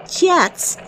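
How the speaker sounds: laughing, incredulous question; amused disbelief. Acted. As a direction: scoff with a laugh. Anchor scoffs at jets report.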